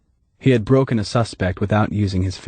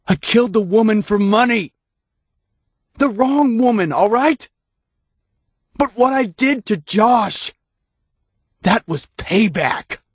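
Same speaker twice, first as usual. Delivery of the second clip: higher, mock-furious yell; yelled confession; 13 semitones higher than usual. A narrator's reading. Dave yelling his confession at Hannah, furious. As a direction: shout it out in defiance. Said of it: "when he yelled".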